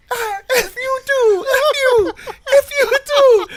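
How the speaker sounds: very high, loud, drawn-out mock screaming; hammed-up shrieked insult, laughter at the edges; retold yelling. Niece returns the middle finger, mock yelling. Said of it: "like, yelling".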